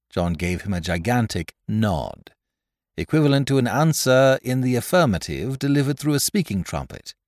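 ordinary delivery, nothing marked

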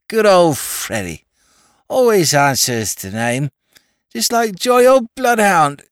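high, slurred tipsy aristocrat; drunken toff. Acted. Drunk lord rambling to the inspector, tipsy and slurred.

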